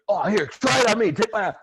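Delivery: high, loud mock eagerness; acted eager kid. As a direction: play a kid volunteering for a wrestling move. Acting out brothers play-wrestling.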